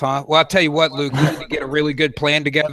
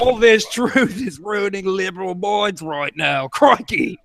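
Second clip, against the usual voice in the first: slow, drawn-out attempt at an Australian accent; 3 semitones higher, slower than usual. Reading a viewer's super chat on request, in a slow mock Australian accent. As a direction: read the super chat like a hammed-up Aussie announcer. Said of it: "you have to read it in Australian voice".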